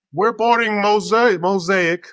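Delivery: mock boarding call; boarding announcement. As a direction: imitate a gate agent calling out.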